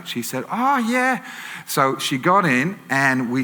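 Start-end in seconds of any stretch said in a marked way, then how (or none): 0.5-1.2 much higher, swooping "oh, yeah"; imitated eager acceptance. Tipsy lady accepts a lift, mock eager high voice. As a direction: pitch it right up, gladly agreeing.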